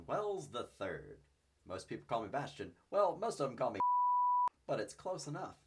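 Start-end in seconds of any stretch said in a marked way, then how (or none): 1.7-5.6 loud, theatrical gentleman persona; character intro voice. Pretend. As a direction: announce yourself grandly. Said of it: "Normally I don't really do paint by numbers style".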